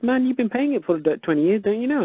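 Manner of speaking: high, disbelieving retort; mock incredulous woman. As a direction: play her amazed scoff, pitched high. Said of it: "She goes".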